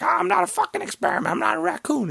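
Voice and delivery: very high, fast, frantic raccoon yelp; unhinged Rocket. Retold or imitated. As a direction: snap like a cornered, furious raccoon. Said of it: "insane and crazy and flies off the hinge".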